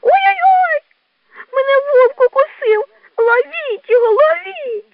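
shrill high panicked squeal. Acted. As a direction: yelp loudly for help, way up high. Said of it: "як заверещить". (верескливо, налякано)

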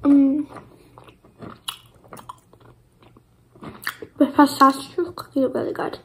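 talking with mouth full; muffled through closed lips; chewing while talking. Real, not acted. Seen 0: Lips pursed, jaw working during speech; cookie only picked up near the end.

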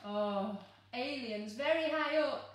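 ordinary delivery, nothing marked

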